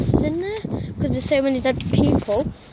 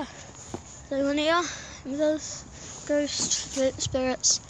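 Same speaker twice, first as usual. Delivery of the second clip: slow, hushed calling out to spirits; talking to a ghost; 7 dB quieter than usual. Real, not acted. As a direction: ask the empty room softly, slowly. EVP session calling spirits.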